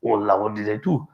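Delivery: a mild hint of panic, quick and low; retold fear. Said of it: "really scared".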